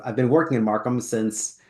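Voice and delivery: ordinary talk, nothing marked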